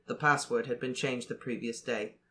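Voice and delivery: plain reading voice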